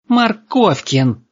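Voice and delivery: very high, drawn-out squeal; piercing high cry of an angry girl. A narrator's reading. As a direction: squeal the surname in outrage. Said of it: "взвизгнула девушка".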